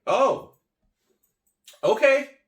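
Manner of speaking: high, loud, mock-taken-aback; startled acceptance. Passerby unexpectedly offered a seat, high startled reply. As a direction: jump up in pitch, caught off guard.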